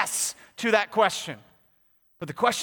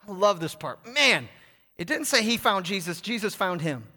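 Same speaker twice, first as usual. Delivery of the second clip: usual speaking voice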